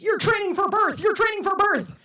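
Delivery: high, loud cheering mom voice; mom's cheer. Retold or imitated. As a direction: cheer it like an upbeat coach mom. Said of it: "her go-to was".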